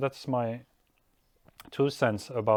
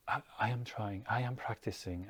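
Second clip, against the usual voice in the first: much softer, tight voice; grown-up holding it together; 9 dB quieter than usual. Retold or imitated. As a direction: hold back tears and keep it quiet. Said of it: "the grown-up holding it up like".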